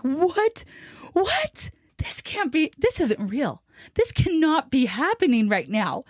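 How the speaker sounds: high, breathy mock-distraught disbelief; retold tearful disbelief. Wife retelling her breakdown over the split key, mock-tearful. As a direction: act out a stunned near-wail, voice jumping high. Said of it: "and I start crying".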